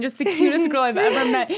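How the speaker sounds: usual speaking voice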